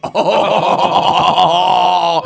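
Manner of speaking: long, high drawn-out "oh"; dawning realization. Retold or imitated. Friend learns it's the mushrooms, amazed realization. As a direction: stretch it out as the penny drops.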